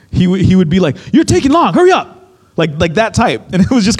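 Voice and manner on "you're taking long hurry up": imitating a brash man rushing the server, high-pitched; mock a rude, impatient demand; rude, impatient customer. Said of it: "He was a little bit rude".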